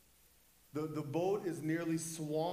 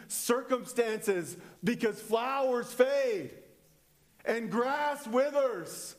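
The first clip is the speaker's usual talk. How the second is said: full-force preaching; 5 semitones higher, 7 dB louder than usual. Real, not acted. Preacher rallying the congregation to stand firm, full-force proclamation. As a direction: build to a high, shouted climax.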